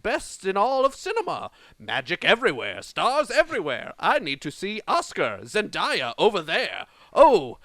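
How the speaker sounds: loud, hammed-up British accent with swooping pitch. Podcaster reading Oscars rap lyrics, mock British accent. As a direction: declaim it grandly in mock British. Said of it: "Read it in your British accent".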